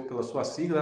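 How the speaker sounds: normal talking voice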